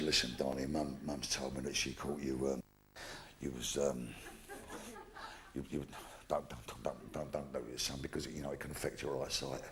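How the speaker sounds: low, soft, stammering grandad; awkward, hesitant talk. Acted. Grandad warning his young grandson, hushed and halting. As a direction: stumble quietly through a delicate warning.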